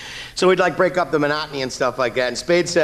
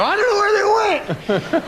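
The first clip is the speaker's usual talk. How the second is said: innocent high voice; 4 dB louder, slower than usual. Retold or imitated. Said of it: "I go".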